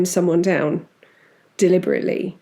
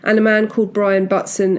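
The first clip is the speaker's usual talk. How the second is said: ordinary delivery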